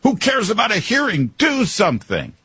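high-pitched imitation of exasperated listeners; mock screaming. Host imitates audience screaming at their radios, mock outraged yelling. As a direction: yell like a fed-up listener at the radio. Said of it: "you're all screaming back at your radio".

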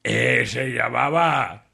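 slow, drawn-out comic veteran host; don Jorge. Acted. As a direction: tell the joke ponderously, stretching each phrase. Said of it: "don Jorge". (voz lenta y pausada de presentador veterano)

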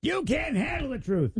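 high, soft "You can't handle the truth"; movie quote impression. Host quoting a movie line to the AI girlfriend. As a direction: toss off the famous line. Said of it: "Just start quoting Top Gun at her".

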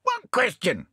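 high-pitched parrot asking back; high parrot character voice. A narrator's reading. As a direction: ask it as a high, pert bird. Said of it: "asked the parrot".

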